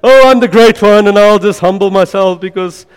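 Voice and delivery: imitating a haughty believer; proud attitude. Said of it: "with this attitude like".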